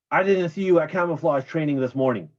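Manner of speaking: loud, stern sergeant bark; growled. Retold or imitated. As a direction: bark it at the young soldier. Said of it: "the sergeant major growled".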